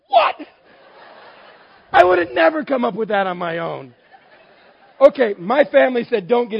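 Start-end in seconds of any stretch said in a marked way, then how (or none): 0.1-4.0 loud, high comic disbelief; hammed-up incredulous shout; astonished outburst. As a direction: exclaim it, utterly floored. Acts out astonishment at her answer.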